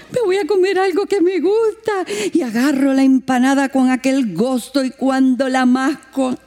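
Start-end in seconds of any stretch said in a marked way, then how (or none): 0.1-2.1 eager delight. Retold or imitated. Her own eager retold words.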